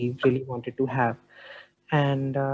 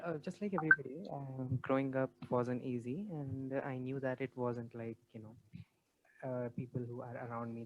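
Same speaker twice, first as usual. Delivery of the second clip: much softer, almost inaudible; barely audible; 13 dB quieter than usual. Real, not acted. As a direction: speak far from the mic, very faint. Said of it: "Your voice is very low".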